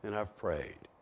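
soft, low, worn-out plea; weary prayer. Acted. A hurting believer praying, soft and weary. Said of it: "times that you feel like".

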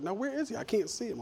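put-on high, puzzled child voice; little girl. A little girl asking the pastor where Jesus is, high and puzzled. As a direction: ask it like a curious seven-year-old. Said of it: "she's like".

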